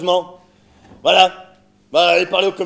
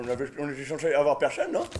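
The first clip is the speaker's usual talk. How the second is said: usual speaking voice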